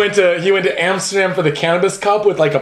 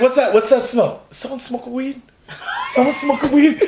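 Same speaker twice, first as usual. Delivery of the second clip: higher-pitched mock suspicion; suspicious boyfriend; 6 semitones higher than usual. Boyfriend questioning girl through bathroom door, mock suspicious. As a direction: act out a boyfriend sniffing out a secret.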